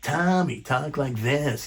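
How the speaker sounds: a horribly done mimic of the club gatekeeper; bad Tommy impression. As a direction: ham up a booker who thinks he's a guru. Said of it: "I do it horribly".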